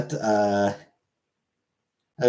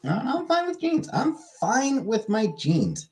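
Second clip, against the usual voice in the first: much higher, slow and sing-song, mock prim refusal; mock squeaky voice; 11 semitones higher, 4 dB quieter than usual. Streamer refusing chat's joggers idea in a squeaky mock voice. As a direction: pipe it up high, primly turning joggers down.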